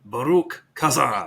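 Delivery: slow, drawn-out war chant; battle cry. Shown as a demonstration. Chanting it back to a friend, slow dwarf battle cry.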